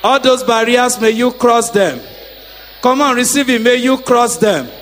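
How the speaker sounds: loud, rhythmic decree; preaching decree. Acted. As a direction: call it out over the crowd. Decreeing blessings to congregation.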